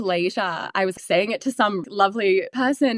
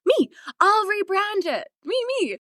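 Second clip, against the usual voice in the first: eager volunteering; 10 semitones higher than usual. Retold or imitated. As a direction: leap in like a kid raising a hand.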